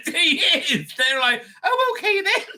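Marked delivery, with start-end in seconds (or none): 1.6-2.5 high-pitched, giddy agreement; swooning women. Acted. Mimics swooning women giving in.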